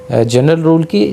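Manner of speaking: ordinary talk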